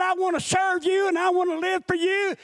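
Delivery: high, insistent preacher's declaration; impassioned preached prayer, climbing in pitch; fervent vow to God. Retold or imitated. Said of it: "I told God".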